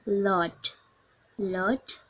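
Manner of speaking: ordinary delivery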